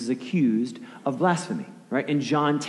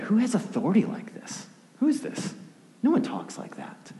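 high-pitched astonished questioning; incredulous onlooker, voice lifted high; amazed disbelief; 10 semitones higher than usual. Acted. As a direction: jump up in pitch, marvelling in mock astonishment. Acted astonished crowd reaction.

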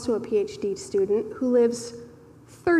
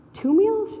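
surprised; 9 semitones higher, 3 dB louder than usual. Retold or imitated. Stunned at generous offer.

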